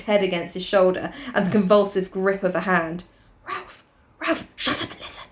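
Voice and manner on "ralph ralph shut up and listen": soft, hissed urgency; urgent whisper. Acted. Piggy hushing Ralph inside the shelter, urgent whisper. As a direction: whisper it sharply.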